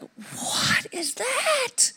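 very high, very slow strained cry; a high, drawn-out gasp of shock; startled pain. Retold or imitated. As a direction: squeeze it out as pain hits. Sudden pain hits her stomach.

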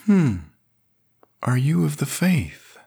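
put-on high, fond voice of an old priest; gentle old priest Sébastien. A narrator's reading. Sébastien's voice in the story.

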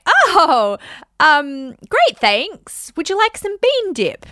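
bright high chirpy deflecting voice; sweet deflection. Shown as a demonstration. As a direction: chirp it up high like an overly cheerful hostess. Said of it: "You can say".

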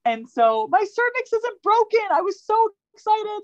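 high, bubbly excited telling; giddy, high-pitched retelling. Retold or imitated. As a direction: let the joy spill over.

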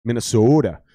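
Minnesota accent with a long round O. Shown as a demonstration. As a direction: lean on the O in "Minnesota", Minnesotan style. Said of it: "anything with an O in it".

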